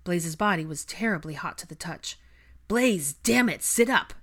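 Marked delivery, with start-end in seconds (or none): 2.7-4.1 loud, high exasperated command; frustrated order. A narrator's reading. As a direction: lose patience trying to rouse him. Frustrated second order to sick friend.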